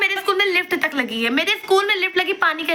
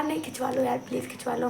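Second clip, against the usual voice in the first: hushed pleading; 4 semitones lower, 11 dB quieter than usual. Retold or imitated. Boys begging for a photo.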